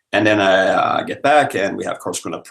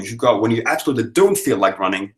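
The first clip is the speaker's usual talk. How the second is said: normal talking voice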